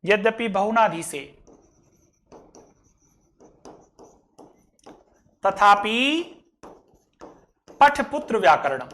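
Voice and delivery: slow Sanskrit recital with long pauses; drawn-out words, set apart; word-by-word verse recitation. Shown as a demonstration. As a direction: give out each word separately for learners.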